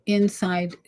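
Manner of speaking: ordinary delivery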